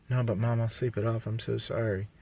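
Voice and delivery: soft, pitiful mock apology; mock pleading teen. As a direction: plead with mom like a hungover kid. Teen self pleading with mom.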